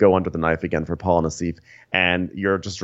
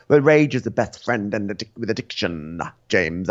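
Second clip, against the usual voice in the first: put-on mild British accent; 2 semitones higher than usual. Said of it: "British accent off".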